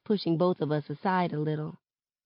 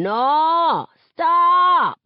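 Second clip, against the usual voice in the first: high, loud, stretched-out protest; horrified shout; 11 semitones higher, 9 dB louder than usual. A narrator's reading. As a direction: yell to make a mortifying parent quit.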